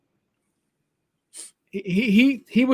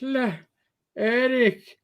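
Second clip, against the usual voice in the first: very slow, higher Irish brogue; slow Irish father; 6 semitones higher, much slower than usual. Retold or imitated. Imitating the Irish father talking down to him, very slow Irish brogue. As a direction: drag each word out like a thick-brogued old Irishman.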